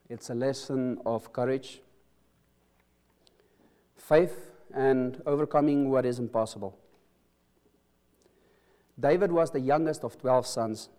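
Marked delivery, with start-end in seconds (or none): none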